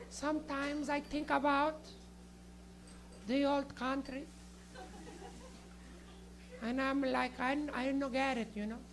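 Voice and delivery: soft, slow, halting nonna; stoned Italian nonna. Acted. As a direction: muse softly with long pauses and Italian accent. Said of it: "getting high with a little nonna".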